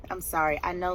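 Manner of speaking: ordinary delivery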